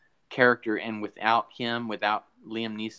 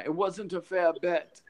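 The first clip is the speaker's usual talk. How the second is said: Watto voice; 7 semitones higher than usual. Retold or imitated. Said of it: "And he's like".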